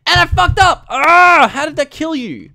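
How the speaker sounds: loud, high cry of frustration at the failed catch; frustrated outburst. Real, not acted. Gamer yelling at his failed Ditto catch, loud and frustrated. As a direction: yell it out as the catch goes wrong.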